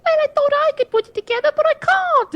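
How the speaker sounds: very high, quick mock voice of his wife; husband imitating his wife's high-pitched excuse; mimicking his wife. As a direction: squeak it out like a helpless excuse. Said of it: "She's like".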